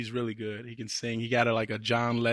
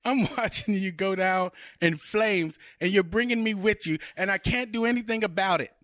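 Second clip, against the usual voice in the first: higher, fretful fiancée voice; acted worry, pitched up; exasperated movie girlfriend; 6 semitones higher than usual. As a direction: play the girlfriend watching disaster helplessly. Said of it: "I'm gonna go with Marissa Tomei's character".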